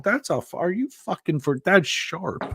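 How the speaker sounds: high-pitched, incredulous protest; retold alarm. Retelling their alarmed refusal.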